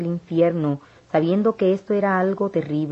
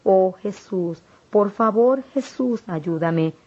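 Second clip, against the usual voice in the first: a retold anguished plea, only lightly acted; pleading cry of pain; 2 semitones higher, slower than usual. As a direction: beg Jesus for help, voice lifting in pain. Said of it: "Yo grité con grande dolor".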